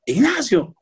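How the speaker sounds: high-pitched calling out; retold yell. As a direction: imitate mum calling for her husband. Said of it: "le grita a mi papá".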